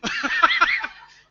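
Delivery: bursts into a high, loud laugh. Real, not acted. Laughing at the hospital mix-up.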